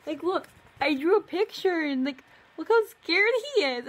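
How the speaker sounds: high and loud, laughing at the drawing; shrieking disbelief. Real, not acted. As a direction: squeal while pointing at the picture. Showing her old drawing, shrieking.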